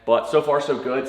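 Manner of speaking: usual speaking voice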